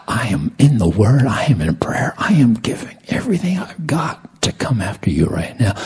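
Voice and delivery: high, impassioned cry to God; desperate plea. Retold or imitated. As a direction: plead with God, pitch climbing as you go.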